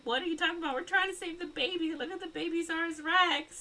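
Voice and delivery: very high, put-on whiny plea; hammed-up squeaky indignation; mock indignant protest. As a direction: imitate clueless rescuers, voice way up high. Retold couple dismissing the warning.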